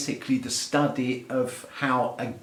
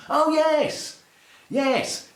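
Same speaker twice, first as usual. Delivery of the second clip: high-pitched, excited discovery; delighted, high-pitched relish; gleeful delight; 13 semitones higher, 3 dB louder, much slower than usual. Real, not acted. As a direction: exclaim as you find what you wanted. Finds the examples he wanted.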